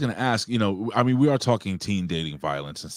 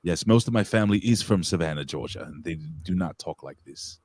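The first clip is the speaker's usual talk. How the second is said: put-on British accent, slowing at the end; 2 semitones lower than usual. Host saying family from Savannah don't talk like this, British accent. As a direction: answer like a mock Brit. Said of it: "you're talking like a Brit".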